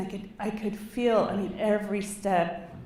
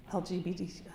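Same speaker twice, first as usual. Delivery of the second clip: usual speaking voice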